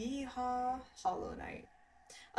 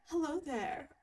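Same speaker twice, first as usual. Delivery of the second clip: higher, lilting posh British greeting; Obi-Wan Kenobi; 6 semitones higher, slower than usual. Acted. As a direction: try out a posh English Jedi voice. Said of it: "Can I do an Obi-Wan Kenobi voice?".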